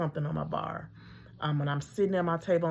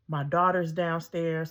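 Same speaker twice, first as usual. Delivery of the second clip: normal talking voice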